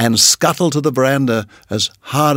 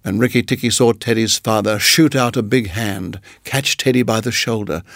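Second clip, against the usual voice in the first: ordinary delivery, nothing marked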